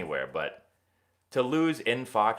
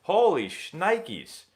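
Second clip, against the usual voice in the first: mock disbelief; 9 semitones higher, much slower than usual. Real, not acted. Exclamation of disbelief.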